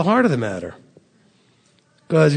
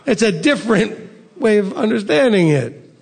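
normal talking voice

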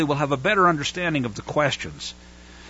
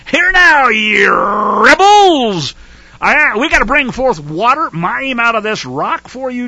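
loud, higher exasperated scolding; frustrated rebuke; 5 semitones higher, 7 dB louder, slower than usual. Retold or imitated. Moses fed up, scolding the whining rebels loudly. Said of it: "This is Moshe in frustration".

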